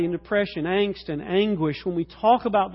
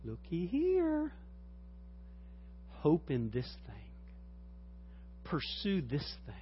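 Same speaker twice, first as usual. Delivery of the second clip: tempting Satan; 3 semitones lower, 11 dB quieter than usual. Retold or imitated. A hint of Satan whispering temptation to a sufferer. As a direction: lure them in gently. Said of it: "Satan steps up ... and says".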